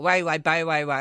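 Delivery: "why" said with a wide "ai" sound instead of wow; Northern Irish "wow" turning into "why"; wow coming out as why. Shown as a demonstration. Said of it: "you're starting to get".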